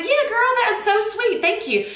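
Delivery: high squealy gushing thank-you; retold high gush. Thanking Heather for the flamingos in a high gush. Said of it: "And I was like".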